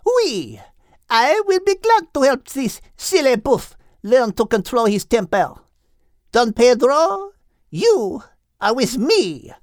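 put-on lightly French-accented lady's voice; a hint of French accent. Voices French characters Liz, Henriette.

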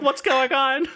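high-pitched, puzzled question; lightly acted high confusion; bewildered newcomer. Voices bewildered newcomer walking in.

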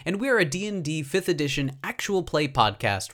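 bright, loud announcer read over music; podcast host intro. Acted. Host opening the show for listeners, loud announcer voice. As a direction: hype up the episode like a radio host.

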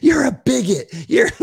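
high, loud mock outrage; hammed-up shocked accusation; mock outraged yell. Mimicking an angry critic calling him a bigot. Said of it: "They just go".

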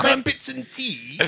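high, slow posh British voice; mock British. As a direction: ham up the crumpets accent. Mocking British stereotypes.

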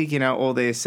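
ordinary delivery

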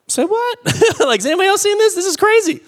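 high, amazed squeal of disbelief; can't believe what he's seeing; stunned disbelief. Retold or imitated. Preacher watching his vision come true, high astonished squeal.

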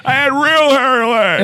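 high, comic politician impression; Joe Biden. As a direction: boast in a put-on president's voice. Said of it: "It's your favorite president".

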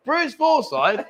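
high, slow disbelieving echo; incredulous. Real, not acted. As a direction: stretch it out, can't believe the answer. Mate's absurd guess.

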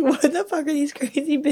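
incredulous bartender, lightly mocked; imitating baffled bartender. Imitating bartender's incredulous look at them.

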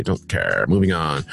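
dropped low and quiet, Don't care muttered; dismissive. Real, not acted. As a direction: brush it off with a bored Don't care. Brushing off a celebrity headline.

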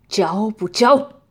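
slow, loud threatening question; menacing demand. Acted. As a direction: demand it slowly, leaning in. Torturer demands a confession.

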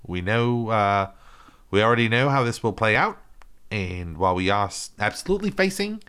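mock Kiwi accent, earnest announcement; New Zealand accent. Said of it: "this is New Zealand accent".

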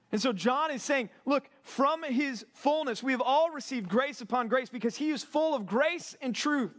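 fervent preacher rising up over the congregation; full-force preaching. Real, not acted. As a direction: preach it out to the men, building conviction.

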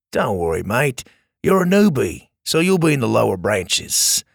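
reassuring Australian mate; calm a nervous kid, Aussie style; Australian accent. Acted. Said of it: "in an Australian accent".

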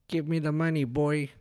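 high put-on gangster-rapper voice; botched Tola voice. Man tries imitating restaurant owner, lands on gangster. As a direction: overdo it like a wannabe rapper, pitched high. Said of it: "trying to be a gangster, like a rapper".

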